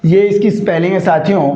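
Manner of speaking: ordinary delivery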